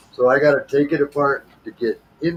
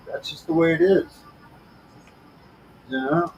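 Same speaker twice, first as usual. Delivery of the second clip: ordinary talk, nothing marked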